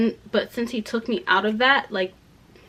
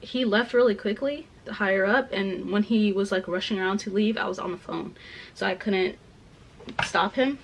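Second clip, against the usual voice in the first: ordinary talk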